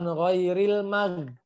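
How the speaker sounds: ghairil mag with thin start and hard g ending; contoh salah, tipis. Shown as a demonstration. (ghairil mag tipis, g keras di akhir)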